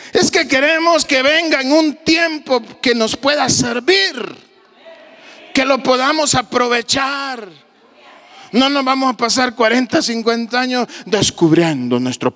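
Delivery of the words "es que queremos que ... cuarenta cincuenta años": preaching shout. Real, not acted. Preacher urging the congregation, shouting. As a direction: raise the voice to stir the church.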